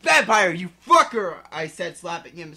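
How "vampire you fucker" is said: loud angry outburst. Acted. Girl slapping Vampire, loud and angry. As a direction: curse him out.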